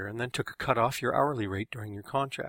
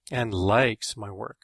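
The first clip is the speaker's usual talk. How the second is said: "likes" lingered on, stressed louder than the rest; drawn-out LIKES; 5 dB louder, much slower than usual. A narrator's reading. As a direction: stretch "likes" out slow and long. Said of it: "drew out the word like".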